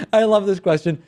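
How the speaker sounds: chuckle, then say it through amusement; amused. Real, not acted. Host tickled by a listener's question, amused. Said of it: "it cracked me up".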